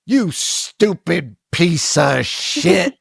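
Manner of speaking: slow, mock-gruff insult, lightly voiced; imitating movie tough guy. Podcaster quotes a movie character's insult, slow and mock gruff. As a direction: drag out the insult like a B-movie thug. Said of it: "a lot of guys being like".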